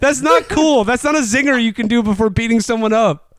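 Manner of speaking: mocking the cheesy movie line, incredulous; incredulous rant. Real, not acted. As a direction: rant at the silly zinger. Ranting disbelief at cheesy line.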